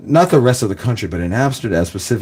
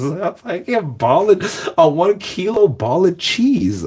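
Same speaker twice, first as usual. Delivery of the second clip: stunned emphasis; 7 semitones higher, slower than usual. Real, not acted.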